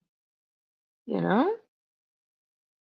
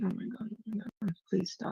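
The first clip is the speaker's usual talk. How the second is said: much softer, fast inner plea; inner panic; 15 dB quieter, faster than usual. Retold or imitated. Thinking to herself while the co-host yells, soft fast pleading. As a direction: murmur it to yourself fast, pleading. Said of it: "I'm sitting there and I'm thinking".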